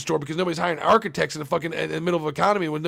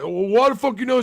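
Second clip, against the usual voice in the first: higher and louder, a fed-up blowup; exasperated outburst; 6 semitones higher, 7 dB louder than usual. Retold or imitated. Divorced man role-playing with therapist, higher, louder blowup. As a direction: throw up your hands, mock angry. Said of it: "he's like".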